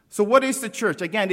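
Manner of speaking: ordinary talk, nothing marked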